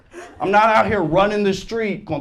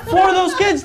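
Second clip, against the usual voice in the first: high, loud comic outburst; loud mock indignation; shouting; 11 semitones higher, 4 dB louder than usual. Real, not acted. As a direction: yell the punchline. Punchline building to shout.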